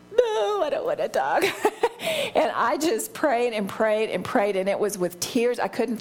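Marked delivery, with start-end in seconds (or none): 0.1-1.4 high-pitched comic whine; mock timid type. Contrasting herself with a type A friend, comically reluctant whine. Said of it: "and I'm a type". 1.5-2.3 high-pitched giggle; laugh at own joke. Real, not acted. Amused by her own impression of herself, high giggle.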